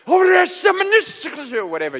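high, shouted mock-Russian gibberish; passionate Russian. Preacher imitating his fired-up translator preaching, passionate Russian shout. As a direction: roar out fake Russian with fervor. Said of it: "a passionate Russian is a sight".

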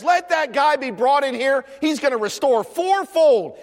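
high-pitched, indignant royal decree; acted fiery command; fired up. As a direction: pronounce sentence in preacher's acted outrage. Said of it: "he is fired up".

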